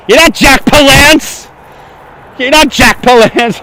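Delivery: high, slow, drawn-out mock exclamation; mock outraged cry. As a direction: call them out in hammed-up outrage. Mock confrontation of imagined passers-by.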